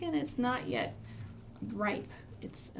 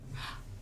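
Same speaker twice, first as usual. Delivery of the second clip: gasp; 11 dB quieter than usual. Acted. Noticing the mystery pot.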